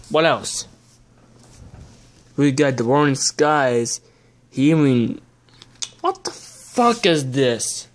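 normal talking voice

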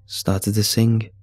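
plain reading voice

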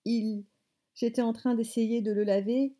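ordinary delivery, nothing marked

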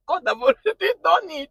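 high, loud retold exclamation; exclaimed punchline. Retells the punchline, then laughs.

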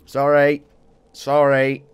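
loud, exaggerated sorry; loud apology. Real, not acted. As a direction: call out sorry.

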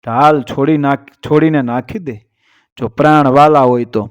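usual speaking voice

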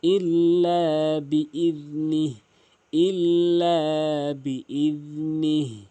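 slow melodic chant, ইল্লা lifted; Quran recitation. Shown as a demonstration. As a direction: recite slowly, lifting ইল্লা.

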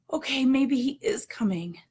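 whisper. Retold or imitated.

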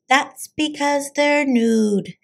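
teasing little sister; little girl voice. Acted. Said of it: "said Jujubee".

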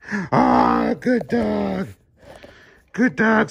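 high squealing praise for the dog; excited praise. Real, not acted. Excited praise after trick.

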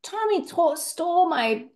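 put-on child telling parent about a stolen pencil, whiny; upset kid. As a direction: complain like a wronged little kid. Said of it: "And they went".

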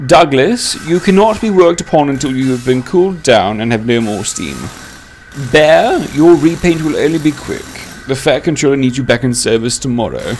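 high, loud official announcing; inspector. A narrator's reading. Inspector gives the engines orders.

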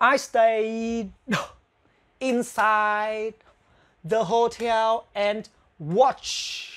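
very slow model reading of "watched", ending cut off; very slow teacher demonstration; slow demo. Shown as a demonstration. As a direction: drag out each word for learners. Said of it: "cái tờ này".

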